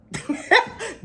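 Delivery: a high, bashful giggle. Real, not acted. Wife giggling about enjoying her husband. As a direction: giggle at your own confession.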